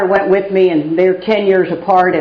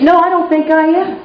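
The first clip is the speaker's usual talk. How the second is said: laughing reply; 9 semitones higher than usual. Retold or imitated. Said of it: "I said".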